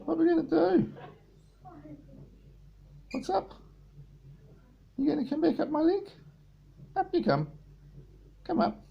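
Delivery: squeaky high voice for the bird. Real, not acted. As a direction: coo up high at the budgie. High pet talk to bird.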